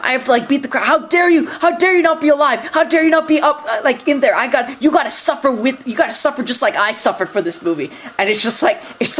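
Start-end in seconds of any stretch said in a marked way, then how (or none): 0.0-7.9 higher, hammed-up indignant scolding; mock outraged rant. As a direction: rage at her in fake fury, peaking on how dare you. Voicing the movie mocking itself.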